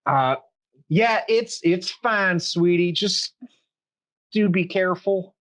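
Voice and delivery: high, anxious barmaid voice; worried barmaid. Acted. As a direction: fret over him gently. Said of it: "and she's like".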